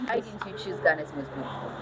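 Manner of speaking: normal talking voice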